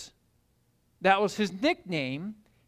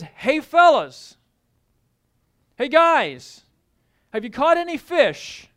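calling out; 5 semitones higher, 6 dB louder, slower than usual. Acted. Voice calling from the shore.

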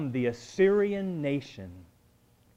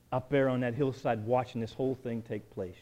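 usual speaking voice

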